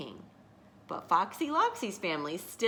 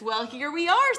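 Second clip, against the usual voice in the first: loud, raised storybook fox voice; triumphant fox; 8 semitones higher, 7 dB louder than usual. A narrator's reading. Fox showing the birds his den, loudly triumphant. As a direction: announce the arrival, high and loud.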